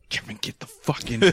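put-on hissed whisper to a drunk friend; urgent whisper. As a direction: whisper it so the cops down the hall can't hear. Hiding drunk friend from cops.

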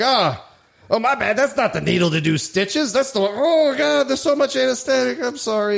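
high-pitched, put-on oops; mock apology. Doctor pretending to fumble in front of a patient, mock apologetic.

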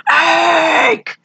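high, drawn-out mock cry of the name; slow-motion yell. Michael's slow-motion cry after Jake, drawn-out yell. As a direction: stretch the shout like a slow-motion scene. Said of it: "slow motion".

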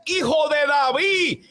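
high, slow desperate cry for mercy; shouted plea. Retold or imitated. Bartimeo crying to Jesus over the crowd, shouted plea. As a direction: cry out as blind Bartimeo begging. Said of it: "O sea, que gritaba más".

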